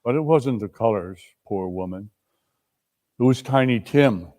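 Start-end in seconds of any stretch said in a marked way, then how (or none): none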